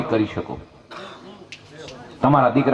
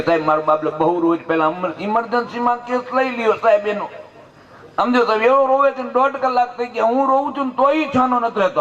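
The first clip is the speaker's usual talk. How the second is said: higher, pressing plea to the doctor; anxious pleading; 9 semitones higher than usual. Retold or imitated. Mother pleads with doctor about crying baby, anxious higher voice.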